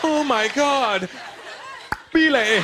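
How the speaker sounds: high, drawn-out mock ecstasy; slow high cry, then the clicked name; woman in ecstasy. Woman answering in lovemaking.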